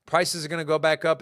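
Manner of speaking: ordinary delivery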